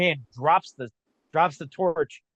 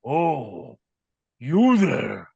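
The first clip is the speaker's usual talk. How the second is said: lightly voiced lumbering monster, drawn-out vowels; a hint of an ugly brute spotting intruders; ogre-like head; 3 dB louder, much slower than usual. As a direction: monster calling out on discovery. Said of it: "an ugly looking bald head".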